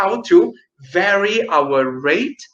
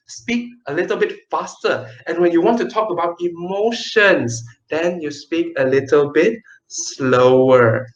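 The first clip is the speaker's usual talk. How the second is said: usual speaking voice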